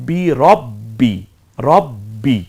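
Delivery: রব্বি with the b held double, slow; রব্বি recited with a doubled, held b. Shown as a demonstration. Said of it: "কি কাজ করলে রব্বি হবে".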